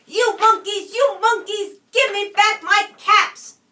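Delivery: angry peddler shouting up at the monkeys in the tree. A narrator's reading. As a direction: demand the caps back, fed up. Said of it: "This made the peddler angry".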